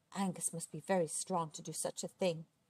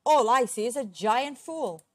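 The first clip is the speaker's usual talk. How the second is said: loud, higher-pitched angry retort; booming giant's comeback; roaring back; 4 semitones higher, 9 dB louder than usual. A narrator's reading. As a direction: roar the insult across the water. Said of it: "he roared back".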